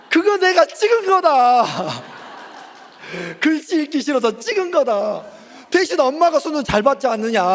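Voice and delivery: high, loud laughing comeback; laughing retort. Retold or imitated. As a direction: defend yourself while cracking up. Father defends himself, laughing. (웃으며 큰 소리로 받아치기)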